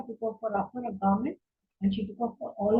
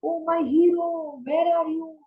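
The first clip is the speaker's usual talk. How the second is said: a frightened plea, pitched up and slowed on the hero line; desperate shout for help; 6 semitones higher than usual. A narrator's reading. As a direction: cry out to Ram as she is carried away. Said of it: "Sita was shouting".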